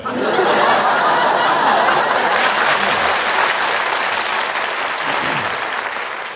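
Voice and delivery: big open laugh, loud and breathy; loud hearty laugh. Real, not acted. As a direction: roar with laughter at your own joke. Laughs at his own joke.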